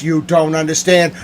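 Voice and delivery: normal talking voice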